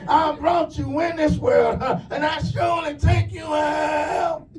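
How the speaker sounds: high, slow hollering preacher voice; high drawn-out sermon shout at the grown son; brimstone preacher grandmother. Retold or imitated. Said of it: "went off on him like a brimstone preacher".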